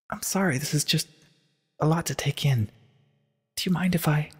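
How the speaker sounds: edge away, overwhelmed; distraught. A narrator's reading. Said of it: "Twilight looked distraught".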